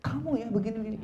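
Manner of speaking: very high-pitched mock scolding; acted marital complaint; nagging wife. Acted wife nagging her husband.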